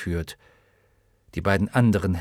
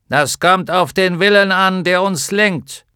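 tinny giant voice; 9 semitones higher, 8 dB louder than usual. Acted. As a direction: speak like a hollow iron giant. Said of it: "mit blecherner Stimme".

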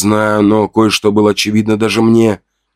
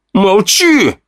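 sharp, much higher shout; barked order; 11 semitones higher than usual. Acted. The narrator barks at Fedya to stop his taunts. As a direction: bark it to shut him up. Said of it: "рявкнул я".

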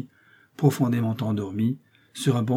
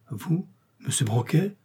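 higher-pitched, incredulous question; astonished exclamation; 5 semitones higher than usual. A narrator's reading. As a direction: exclaim in surprise at who it is. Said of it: "s'écria la terreur".

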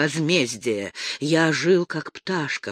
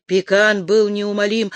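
ordinary delivery

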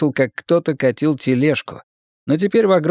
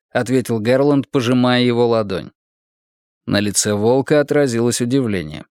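plain reading voice

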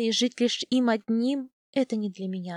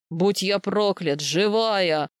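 a slow, lower, louder bellow; mild mock roar of joy, lower; 3 semitones lower, 4 dB louder, much slower than usual. A narrator's reading. Gerhard finds the lost girl alive: roaring. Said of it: "заревел он".

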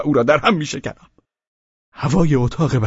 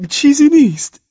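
loud and much higher; loud reply; 11 semitones higher, 6 dB louder than usual. Acted. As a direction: call it out loudly. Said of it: "با صدای بلند جواب داد".

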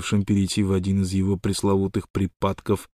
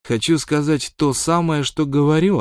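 curt retort; 7 semitones higher than usual. A narrator's reading. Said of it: "отрезал малыш".

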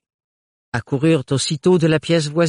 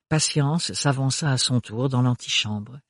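normal talking voice

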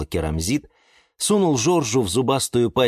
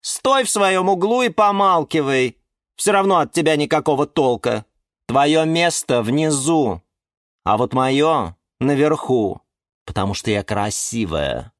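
haughty orchid; 6 semitones higher, 3 dB louder than usual. A narrator's reading. Orchid scorns the geranium.

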